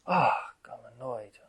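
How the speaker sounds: loud, slow exasperated 'Ah, I'm annoyed'; exasperated groan. Retold or imitated. Meditator fed up with their own restlessness, slow loud exasperation. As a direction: groan it out like you can't settle. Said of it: "and you're like".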